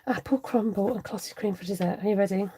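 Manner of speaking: ordinary talk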